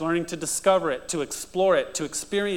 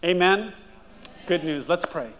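normal talking voice

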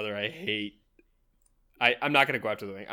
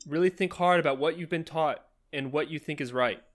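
higher, pressing appeal; Hirak urging; 5 semitones higher than usual. Acted. Hirak calling out to Runzi, higher and urgent. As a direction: lean in, urge him to think. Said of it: "I'm gonna say".

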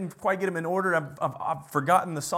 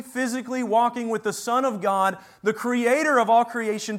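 usual speaking voice

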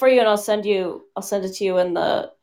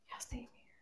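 whispering; 30 dB quieter than usual. Real, not acted. Whispered aside.

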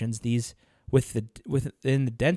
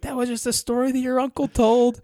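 high, louder imitation of a woman; dismissive woman; 12 semitones higher, 5 dB louder than usual. Aunt answering a scared child, dismissive. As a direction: voice her brushing off a child's fear.

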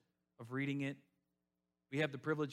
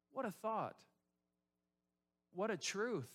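normal talking voice

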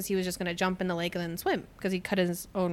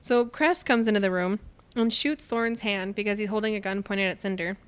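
normal talking voice

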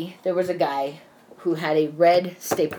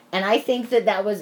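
normal talking voice

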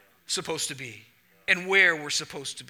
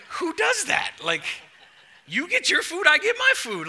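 incredulous; 10 semitones higher, 4 dB louder than usual. Retold or imitated. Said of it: "She's like".

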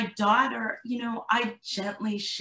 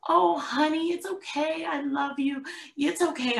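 imitating nurturing mom; 7 semitones higher than usual. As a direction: coo over a hurt child like a doting mother. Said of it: "Now here's me, the nurturing".

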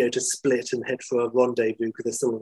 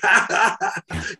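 high, put-on guffaw; big imitated belly laugh; Michael Caine; 9 semitones higher than usual. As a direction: burst into a hammed-up guffaw. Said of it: "pure Michael Caine".

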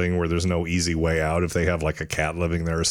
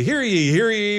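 high ringing herald cry; town crier call; 12 semitones higher, 3 dB louder than usual. Acted. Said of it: "And we say".

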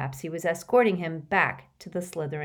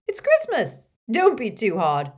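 put-on much higher, louder, jolly tipsy old teacher; tipsy hiccuping professor; 5 semitones higher, 8 dB louder than usual. A narrator's reading. Slughorn pleads with Snape at the party, high and tipsy. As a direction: coax him to go easy, a little drunk on Christmas cheer. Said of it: "said Slughorn, hiccuping again".

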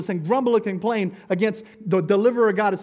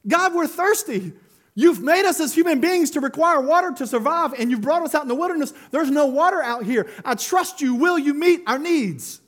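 higher-pitched, earnest plea to God; trusting plea; 6 semitones higher than usual. Acted. Thirsty people in the wilderness asking God for water, trusting plea. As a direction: bring the complaint up high, trusting him. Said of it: "Is very different than".

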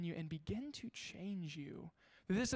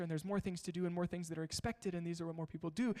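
speeding up; faster than usual. Shown as a demonstration. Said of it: "even as I'm talking really fast".

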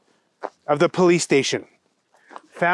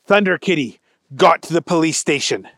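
usual speaking voice

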